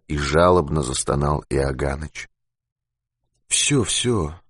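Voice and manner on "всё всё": soft, soothing hush; calm someone who is moaning; tender, quieter reassurance; gently. A narrator's reading. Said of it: "ласково сказал Юрковский".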